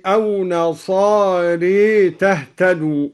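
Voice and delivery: نصاري ending in a long e, "nasaree"; slowly tilt the alif after the r of نصاري to e; imala after ra. Shown as a demonstration. Teacher demonstrating al-Duri's imala, نصاري said "nasaree". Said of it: "وأمال الألف بعد الراء".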